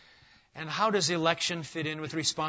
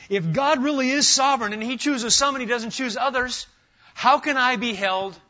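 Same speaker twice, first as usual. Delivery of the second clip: higher, louder protest of a challenger; raised indignant challenge; indignant objector; 8 semitones higher, 7 dB louder than usual. Acted. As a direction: push back hard, as the objector. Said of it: "I have another objection".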